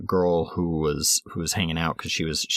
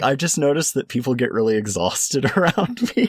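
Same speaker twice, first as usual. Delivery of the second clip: laughing; 6 semitones higher, 4 dB louder than usual. Retold or imitated. Laughing through the in-character line.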